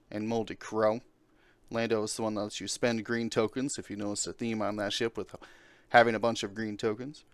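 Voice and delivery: ordinary talk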